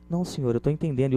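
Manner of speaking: softly placating salesperson; hushed acted reply from behind the counter; speaking low. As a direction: keep it soft and deferential to the customer. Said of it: "falando baixo".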